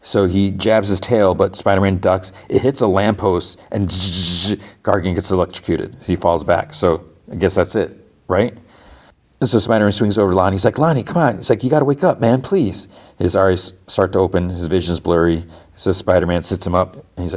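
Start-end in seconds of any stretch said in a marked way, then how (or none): 3.9-4.6 buzzing zap noise; imitated electric zap. Storyteller as the tail hits a lamppost, imitated zap. 10.8-11.4 high-pitched mock plea; retold urgent pleading. Hero to his unconscious friend, retold urgent plea. Spider-Man urges Lonnie awake. 11.6-12.9 higher-pitched begging; retold urgent pleading. Hero to his collapsed friend, retold desperate plea. Spider-Man begs Lonnie to wake.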